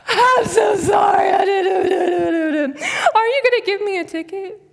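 high, loud hammed-up sobbing wail; mock bawling. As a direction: bawl it out like a comic crybaby. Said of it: "I'm blubbering".

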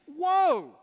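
loud, high "Whoa!" of astonishment; astonished whoa. Retold or imitated. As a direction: gasp out loud in amazement. Mock astonishment of the listeners.

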